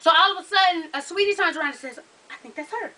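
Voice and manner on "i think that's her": very soft imitated aside; hushed recognition. Fan spotting her from nearby, hushed imitation. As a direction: drop right down, as if confiding in a friend.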